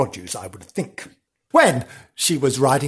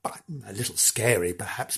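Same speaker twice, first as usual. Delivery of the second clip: ordinary delivery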